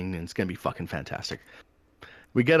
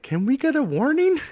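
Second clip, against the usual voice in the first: high, loud imitation of a plea; hopeful plea; 6 dB louder than usual. Back-seat passenger asking the guard for leniency, hopeful plea. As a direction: imitate a passenger trying his luck.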